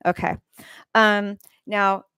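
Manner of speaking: ordinary delivery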